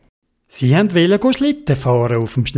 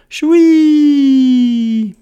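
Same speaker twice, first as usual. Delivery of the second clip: high loud whoosh, sliding slowly down; long gleeful whee; 10 semitones higher, 6 dB louder than usual. Acted. Squirrel and friends race off on the sled, gleeful squeal. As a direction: let out one long high "shwee".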